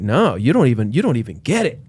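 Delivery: ordinary delivery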